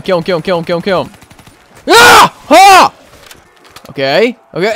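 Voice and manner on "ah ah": two short high yelps; startled screams. Real, not acted. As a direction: shriek as if suddenly hit. Startled by zombie hit.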